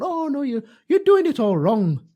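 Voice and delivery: high-pitched mock accent, nagging; Jackie Stewart impression. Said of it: "I don't know what accent I'm doing there".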